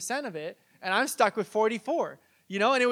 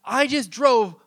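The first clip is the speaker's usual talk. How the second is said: angry dad rant; 5 semitones higher, 8 dB louder than usual. Retold or imitated. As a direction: rant like a dad stuck waiting to turn. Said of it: "He's like".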